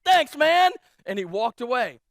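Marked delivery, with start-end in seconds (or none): 0.0-0.7 loud, much higher, cheerful thanks; a quick upbeat thank-you; hearty thanks. Retold or imitated. Pastor thanking the cussing visitor, loud and cheery. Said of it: "I was like".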